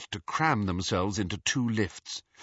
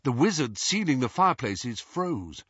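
usual speaking voice

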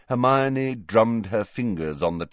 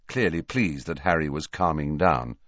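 ordinary delivery

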